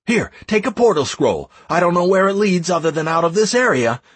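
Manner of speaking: high, urgent run of commands; shouting orders. A narrator's reading. Leader dispatching clans and advisors, shouting orders. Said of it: "I shouted to the leader of some other clan".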